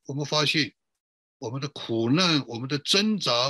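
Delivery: usual speaking voice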